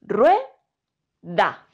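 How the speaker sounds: rue, da in two clear syllables; syllabified. Shown as a demonstration. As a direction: say rue as one beat, then da. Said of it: "Yo las pronuncio en un solo golpe de voz".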